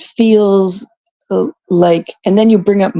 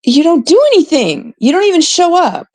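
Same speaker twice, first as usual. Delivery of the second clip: a real exasperated complaint, pitched up and pushed; frustrated outburst; 9 semitones higher than usual. Real, not acted. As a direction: let the frustration burst out. Frustrated rant at absent moderator.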